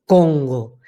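CONGO low, from the back of the palate; back resonance. Shown as a demonstration. Teacher shows the back voice station, low and resonant. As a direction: Congo placed deep behind on the palate. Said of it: "ahí detrás por el paladar".

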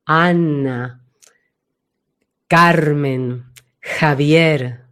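names intoned low, from the back; slow, deep, resonant list of names; deep placement. Shown as a demonstration. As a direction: let each name drop and resonate. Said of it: "Tienen mucha profundidad".

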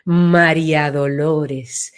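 a broad, round, slow María Dolores; widened, full sound. Shown as a demonstration. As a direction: let the name spread out slowly and fully. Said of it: "se ensancha".

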